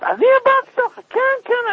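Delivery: high retold tearful cry of disbelief. Child learns he once hit his mother, high tearful cry. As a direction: ask through tears, horrified. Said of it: "אז מתחיל לבכות".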